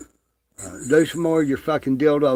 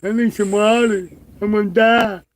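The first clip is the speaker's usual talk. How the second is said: much higher, louder whimpering mimicry; hammed-up mock crying plea; mock crybaby; 10 semitones higher, 7 dB louder than usual. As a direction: ridicule him as a scared crybaby. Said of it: "crying like a little bitch".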